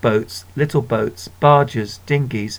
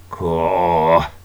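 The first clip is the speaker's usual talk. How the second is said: much lower, drawn-out caw of wonder; impressed gasp; 10 semitones lower than usual. Acted. Bobby hearing of invasion, awed caw. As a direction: gasp it out in awe.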